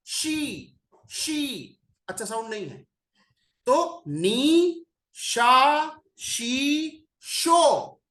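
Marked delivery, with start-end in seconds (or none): 4.1-8.0 slow, separate नी, शा, शी, शो; each syllable given alone; listing syllables. Shown as a demonstration. Teacher lists the bad syllables one by one. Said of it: "चौथी sound है शो".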